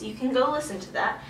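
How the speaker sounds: usual speaking voice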